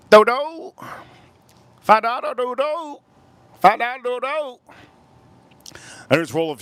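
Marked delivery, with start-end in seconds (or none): 0.1-4.6 high, put-on "Five dollar doo-doo" with the accent held throughout; squeaky caricature hawking "Five dollar doo-doo". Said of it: "That's how the Asians talk".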